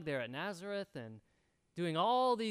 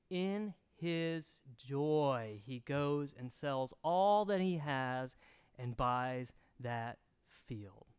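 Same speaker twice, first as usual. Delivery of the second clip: drawn out, each word weighted; slow emphatic reading; 3 semitones lower, 3 dB louder, slower than usual. Acted. Preacher slowing the verse for his congregation, drawn out. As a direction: linger on the verse so it sinks in.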